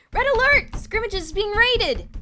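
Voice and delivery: very high, loud, slow alarm call; alarm shout. A narrator's reading. Boys banging on doors, shouting the alarm. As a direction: raise the alarm down the corridor.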